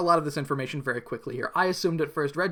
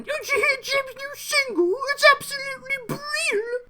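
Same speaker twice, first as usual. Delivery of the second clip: very high falsetto British lady gossip; mock British lady voice; posh British ladies. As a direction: squeal it like a chatty London lady. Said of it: "They're going".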